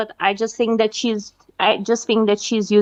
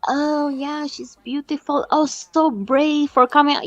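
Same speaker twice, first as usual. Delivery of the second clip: imitating fawning supporters in a raised pitch; gushing crazy fan; 6 semitones higher than usual. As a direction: coo like a besotted fan. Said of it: "crazy stans that are like".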